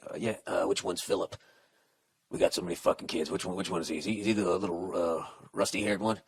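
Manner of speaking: low, soft, mumbling dopey husband voice; mock clueless husband. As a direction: play the dim husband muttering. Mocking the husband's clueless answer.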